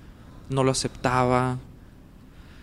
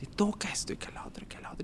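whispered rant; 7 semitones higher, 11 dB quieter than usual. Retold or imitated. Whispering over his angry words to an uncle, unvoiced. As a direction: rant under the breath, hushed. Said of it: "le empiezo a decir de cosas".

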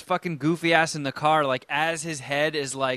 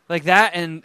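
ordinary talk, nothing marked

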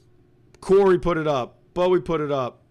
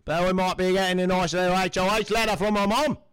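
put-on Australian accent; 3 semitones higher, faster than usual. Co-host cheering on Bowie in a put-on Australian accent. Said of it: "that's a good Australian accent".

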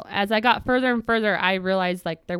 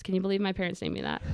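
normal talking voice

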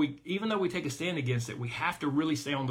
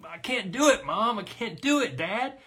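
put-on whiny frustrated child; 6 semitones higher, 3 dB louder than usual. Child near tears complains.

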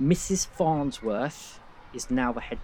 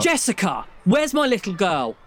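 loud, high anguished demand; desperate father; 7 semitones higher, 6 dB louder than usual. Acted. Father begging for his missing girl, loud and anguished. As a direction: plead and demand at once. Father pleading for lost daughter.

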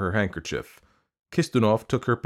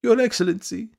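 high, piteous appeal; plaintive high-pitched plea; pitiful piping. A narrator's reading. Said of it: "piped Madame Stuchkin in a pitiful voice".

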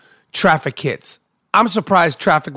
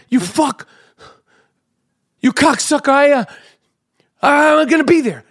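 high, frantic cursing down the phone; hammed-up yell, pitched up and exasperated; 7 semitones higher than usual. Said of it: "So I'm yelling at him".

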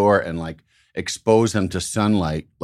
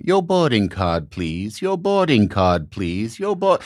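put-on German-accented singsong announcement, dropping on "please"; German flight attendant; slower than usual. Flight attendant calling for boarding cards, German-accented routine. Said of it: "flight attendant that was going".

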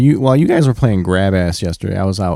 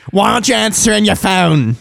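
nagging mom; 11 semitones higher than usual. Retold or imitated. Imitating Ian's mom.

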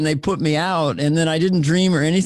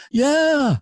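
high Liverpool answer that sounds like asking; Liverpudlian questioning lilt; 9 semitones higher, slower than usual. Shown as a demonstration. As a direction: swoop up in Scouse. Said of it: "everything sounds like a question".

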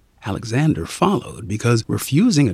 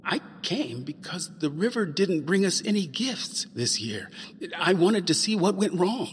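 put-on timid boy; 6 semitones higher, 7 dB quieter than usual. Boy answers dragon.

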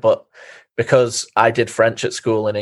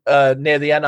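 normal talking voice

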